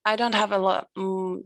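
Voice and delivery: ordinary talk, nothing marked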